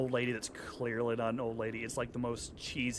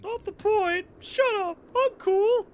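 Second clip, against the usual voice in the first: much higher, louder squeaky mockery; mocking echo; 10 dB louder, slower than usual. Gamer mocking a cutscene character, squeaky and loud. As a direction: parrot the character to make fun of him.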